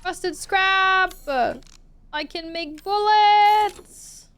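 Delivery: drawn-out delighted exclamation; excited discovery. Real, not acted. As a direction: celebrate finally finding it.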